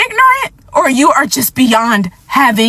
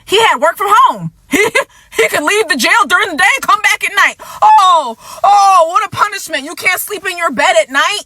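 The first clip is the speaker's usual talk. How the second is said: sarcastic mock outrage; 6 semitones higher than usual. Real, not acted. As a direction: scoff at how light the punishment was. Sarcastic rant about the sentence.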